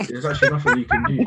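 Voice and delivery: laughing while he talks; amused, breaking into laughter. Real, not acted. Laughing at the situation.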